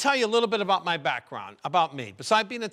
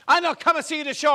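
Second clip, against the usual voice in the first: high, loud Italian mama insisting; mother's Italian accent; 9 semitones higher, 5 dB louder than usual. Retold or imitated. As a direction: dig in like a stubborn Italian mother. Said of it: "she's got the accent".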